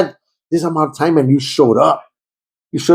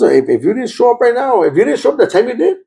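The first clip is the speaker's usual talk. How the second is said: wife's ultimatum; 9 semitones higher than usual. Retold or imitated.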